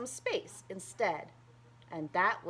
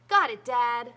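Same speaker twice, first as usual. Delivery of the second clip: put-on eager child; 3 semitones higher, 9 dB louder than usual. A narrator's reading. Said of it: "Harrison replied".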